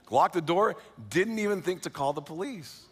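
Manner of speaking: ordinary delivery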